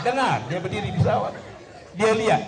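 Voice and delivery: ordinary talk, nothing marked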